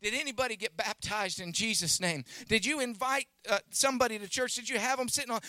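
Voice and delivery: ordinary talk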